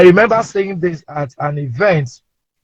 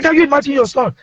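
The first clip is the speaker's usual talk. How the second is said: high-pitched aggrieved complaint; re-enacted flustered father complaining; retold indignation; 8 semitones higher than usual. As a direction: protest to your wife, voice lifted.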